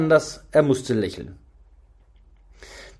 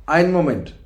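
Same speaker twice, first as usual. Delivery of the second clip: mildly brusque announcement; a touch of gruff impatience; almost brusque; 3 semitones higher, 4 dB louder than usual. A narrator's reading. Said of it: "sagte dann beinahe barsch". (beinahe barsch)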